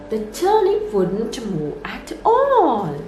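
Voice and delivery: plain reading voice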